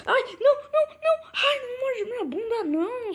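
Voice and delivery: high, loud panicked scream. Real, not acted. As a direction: scream as the pig bites. Pig biting him in game.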